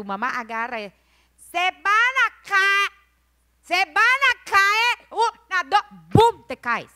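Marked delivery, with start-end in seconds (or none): 1.5-5.8 high, slow, loud scolding call; mock-mom holler, stretched out; mother's shouted warning. Mother warning children on the hammock. 6.1-6.5 sudden loud, high boom; explosive spoken crash; crash sound. Acted. Imitates the crash of falling.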